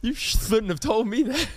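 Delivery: "shouldn't" lisped with th for sh; mock lisp. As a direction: needle a friend by lisping the start of "shouldn't". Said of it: "I have a lisp".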